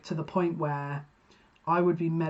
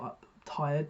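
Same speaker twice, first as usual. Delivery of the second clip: ordinary talk, nothing marked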